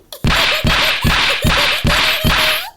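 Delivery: high, loud mocking cackle; gloating cackle. Witness mocking the attorney with a loud high cackle. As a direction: cackle in her face.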